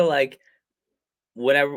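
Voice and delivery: normal talking voice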